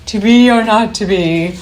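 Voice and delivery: hammed-up actor on a stage; stage soliloquy. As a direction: declaim it like a mock Shakespearean soliloquy. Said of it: "deliver a Macbeth kind of soliloquy".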